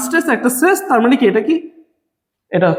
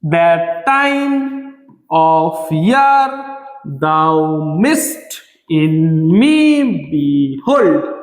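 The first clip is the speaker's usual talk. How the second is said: very slow iambic chant, leaning on every second syllable; scansion stress demo; 8 semitones lower, much slower than usual. Shown as a demonstration. Teacher showing students iambic unstressed-stressed beats, exaggerated scansion stress. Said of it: "একটা unstress".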